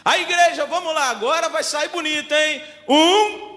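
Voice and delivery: loud, high call to the congregation; rally the whole church, then count off. Real, not acted. Preacher urges the church to get it right, loud rallying.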